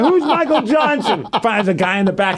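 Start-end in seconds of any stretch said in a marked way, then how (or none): 0.0-1.3 high, laughing repeat; laughing question. Retold or imitated. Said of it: "I'm like".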